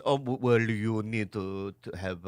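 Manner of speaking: slow, soft, halting doctor impression; Doctor Kawashiri. Retelling his doctor's advice, slow hushed impression. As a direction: drag out each word in a low murmur. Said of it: "Doctor Kawashiri before the pandemic".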